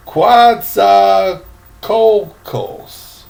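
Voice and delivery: Coatzacoalcos stretched out very slowly, each syllable drawn; very slow pronunciation. Shown as a demonstration. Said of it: "some port I can't even pronounce".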